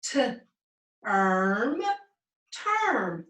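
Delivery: segment slowly T, then erm, then blend into term; sounding out a word. Shown as a demonstration. Teacher to class, sounds out term slowly.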